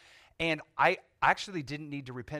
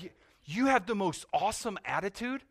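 enthusiastic officer; 7 semitones higher, 4 dB louder than usual. Retold or imitated. Officer praising him, retold.

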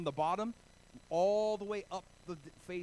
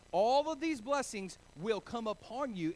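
louder, higher proclamation with "these" punched; emphatic reading; 6 semitones higher, 6 dB louder than usual. Shown as a demonstration. Pastor repeating the scripture to the congregation, loud and emphatic. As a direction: proclaim it with full force. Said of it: "And he says all of these blessings".

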